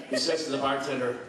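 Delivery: usual speaking voice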